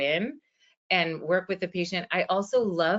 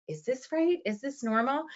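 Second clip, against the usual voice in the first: imitating an uncertain patient, higher-pitched rising questions; unsure patient's questioning; 7 semitones higher than usual. Therapist voices a patient doubting home exercises, unsure. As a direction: ask in a higher, tentative voice, like a patient.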